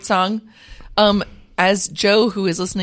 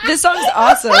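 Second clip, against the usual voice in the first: laughing through the words; 8 semitones higher, 7 dB louder than usual. Real, not acted. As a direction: giggle while you say it. Laughing while praising song.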